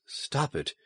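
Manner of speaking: very soft, pleading hush; desperate whisper. A narrator's reading. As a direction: plead desperately under your breath. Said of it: "she whispered desperately".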